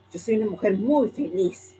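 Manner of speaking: says she's happy but sounds irritated; annoyed. Shown as a demonstration. Teacher demonstrates a happy claim said crossly, annoyed.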